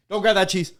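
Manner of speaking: mock warning to a customer; sudden warning. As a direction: startle them mid-reach. Startling a customer at the cheese.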